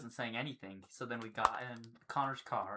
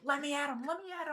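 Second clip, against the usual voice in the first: high-pitched mock frenzy; raging kid imitation; 14 semitones higher, faster than usual. Imitating the kid held back by dad, high mock rage. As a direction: squeal it like someone straining to get loose.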